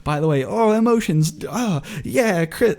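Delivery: high, swinging mock cheer; emotional roller coaster. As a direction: flip between cheering and panic. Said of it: "a roller coaster of emotions".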